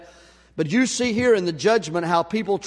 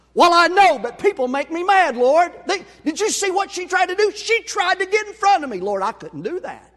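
high, louder, aggrieved pleading; whining to God; 10 semitones higher, 4 dB louder than usual. Retold or imitated. To the Lord after the drive-thru, high aggrieved whining. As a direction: protest like a wronged child to the Lord.

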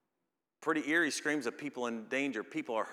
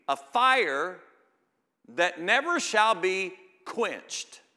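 higher, louder and slow, the words stretched out; preaching at full force; 7 semitones higher, 9 dB louder, much slower than usual. Acted. Preacher thundering the scripture about unquenched fire. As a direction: thunder it, leaning on every word.

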